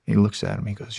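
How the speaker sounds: ordinary talk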